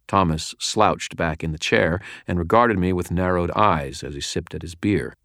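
ordinary delivery, nothing marked

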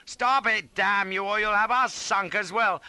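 loud, high angry command; furious shouting. A narrator's reading. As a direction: shout him off the reins. Struggling with East for the reins.

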